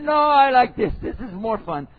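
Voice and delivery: high, loud defiant wife; wife defying her husband, high and loud. Acted. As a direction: push back like a stubborn wife. Wife refuses husband, acted.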